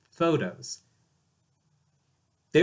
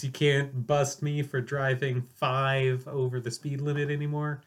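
ordinary delivery

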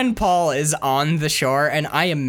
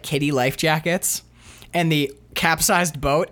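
normal talking voice

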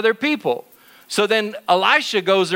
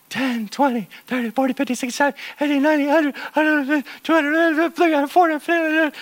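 anxious counting; 7 semitones higher than usual. Acted. Jimmy counts the enemy forces.

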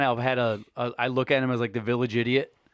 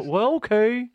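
baffled dad; 9 semitones higher than usual. Retold or imitated. Imitates dad giving in.

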